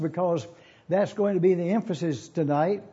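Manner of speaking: ordinary talk, nothing marked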